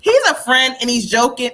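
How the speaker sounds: normal talking voice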